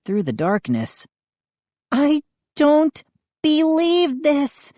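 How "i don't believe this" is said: crying out. A narrator's reading. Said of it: "Mindy cried".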